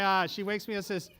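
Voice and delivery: ordinary delivery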